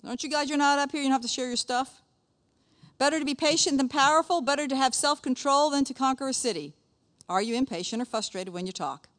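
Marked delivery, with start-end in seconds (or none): 3.0-6.8 high, projected reading voice; scripture reading. Acted. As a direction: read the proverb out to the room. Reads the proverb again.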